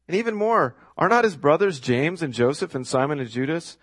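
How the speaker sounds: scoffing crowd, pitch swooping up; high, incredulous sneer; sarcastic. Acted. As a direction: list them off in mock disbelief. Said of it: "Can you hear the sarcasm here?".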